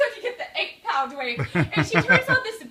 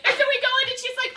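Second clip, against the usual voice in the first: normal talking voice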